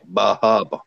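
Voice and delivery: Bar Harbor again in Maine accent, bah HAH-buh. Shown as a demonstration. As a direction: Maine accent, both R's dropped. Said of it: "what we would say".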